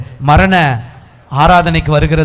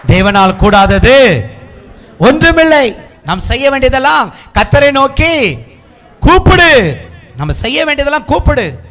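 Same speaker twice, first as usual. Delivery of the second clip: full-force preaching; 9 semitones higher, 4 dB louder than usual. Real, not acted. Preacher urging the congregation to call on God, high and forceful. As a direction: ring each phrase out to the crowd.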